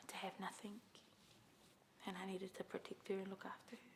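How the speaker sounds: whispered confession; soft whispered admission. Real, not acted. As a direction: whisper it, tender and protective. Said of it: "hushed confession".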